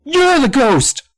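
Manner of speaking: very high, loud accusing yell; crying out. A narrator's reading. Sam accusing the old man, crying out. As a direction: shriek the accusation, stretched out. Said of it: "Sam cried".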